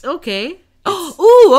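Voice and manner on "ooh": surprised gasp. Real, not acted. Sudden realisation of the game.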